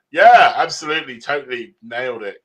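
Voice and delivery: big emphatic yeah, then settling; mock boasting. Author jokingly taking credit for cross genre, mock boastful. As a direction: play at smugly taking the credit.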